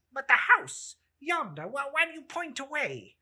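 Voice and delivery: anxious Scrooge. Acted. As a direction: press the spirit, hurried and uneasy. Scrooge questions the spirit.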